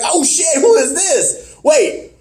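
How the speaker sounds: high-pitched, excited realization; mock astonishment. Viewer spotting a second masked figure, mock astonishment.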